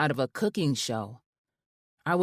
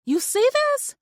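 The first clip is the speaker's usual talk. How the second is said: very high, loud squeal; 5 dB louder than usual. A narrator's reading. Wife squealing to friend on video, delighted. Screams at the room.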